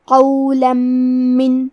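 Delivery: very slow قولا من, with the n merged into a nasal doubled m; nasal merge. Shown as a demonstration. Teacher shows students the idgham with ghunna, very slow. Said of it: "غنہ اور تشدید کے ساتھ ادا کریں گے".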